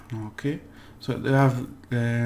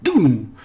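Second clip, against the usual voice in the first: loud, short "Doom!" like a drum hit; a sharp punch of a sound; punchy doom; 2 semitones higher, 9 dB louder than usual. Shown as a demonstration. Teacher voicing the punch of an accent, punchy. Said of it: "It's like".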